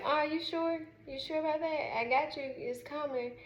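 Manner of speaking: high sing-song teasing reassurance; mock teasing. Daughter reassures doubting mom, high teasing voice. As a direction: tease mom like she's silly to doubt.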